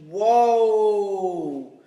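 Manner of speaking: long, loud drawn-out whoa; loud celebratory whoa; excited whoa. Real, not acted. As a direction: let out a big, stretched cheer of amazement. Celebrating last week's record winner.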